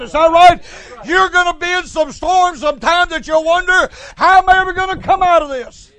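loud, rising preacher's exhortation; preaching at full force. Acted. Preacher warning the church about storms, preaching loud. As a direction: drive it home to the congregation.

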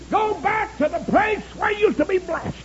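high, urgent pulpit cry; high-pitched preached exhortation; impassioned preaching plea. Acted. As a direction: plead it high like a revival preacher. Preaches a dying man's plea.

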